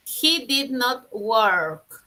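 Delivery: very slow, words set apart; slow model sentence. Shown as a demonstration. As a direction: dictate it clearly. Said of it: "Si quieres decir".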